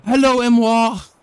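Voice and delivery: high, loud cheerful hello; excited greeting. Real, not acted. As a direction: call out a bright welcome to a fan. Streamer greets a new subscriber.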